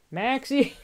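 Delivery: high, loud call for the dog. Real, not acted. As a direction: call the dog across the house. Calling the dog.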